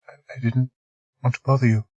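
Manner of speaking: falter, unsure of each word. Acted. Margaret faltering to the doctor, soft and halting. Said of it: "she faltered".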